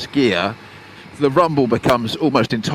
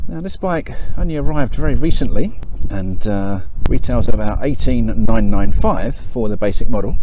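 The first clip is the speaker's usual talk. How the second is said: presenter intro; 7 dB louder than usual. Acted. Walk-around presenter intro.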